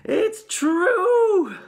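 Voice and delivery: high, drawn-out, very loud cry. A narrator's reading. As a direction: cry it out like a chorus of old folk. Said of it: "cried all four of the old people at once".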